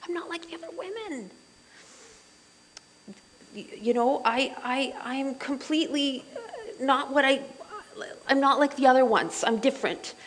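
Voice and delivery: voicing the Shulamite. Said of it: "There's an unworthiness and humility".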